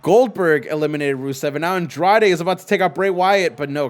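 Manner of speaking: ordinary talk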